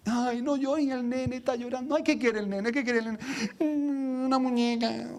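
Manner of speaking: hammed-up cooing parent fussing over a child; whiny, stretched singsong, imitating the fuss; coddling a crying child.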